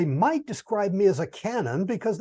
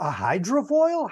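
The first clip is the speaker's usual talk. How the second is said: surprised; 6 semitones higher, slower than usual. Real, not acted. Reacting to a thrown word.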